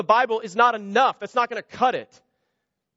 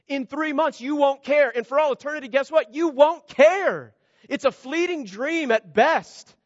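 rising preaching emphasis; 6 semitones higher than usual. Real, not acted. Preacher driving home a point.

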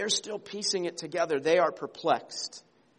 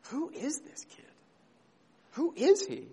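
high, hushed amazed question; amazed parents; 9 semitones higher than usual. Retold or imitated. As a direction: marvel at the boy, breathlessly. Parents' amazed question about Jesus.

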